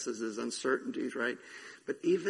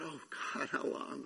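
quiet plea; 8 dB quieter than usual. Retold or imitated. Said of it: "I still said".

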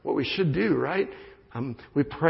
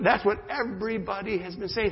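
normal talking voice

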